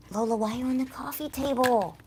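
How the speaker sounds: high, exasperated call to a pet; fed-up pet scolding; scolding the cat. Real, not acted. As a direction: catch the cat misbehaving. Cat on the coffee table again.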